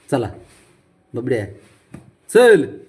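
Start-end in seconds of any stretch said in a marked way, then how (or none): 2.3-2.8 loud, high call. Real, not acted. Owner ordering the pet to come along, calling loudly.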